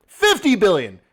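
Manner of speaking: loud, incredulous exclamation; real yelling. Real, not acted. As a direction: yell the figure to drive it home. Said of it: "Is he yelling at me?".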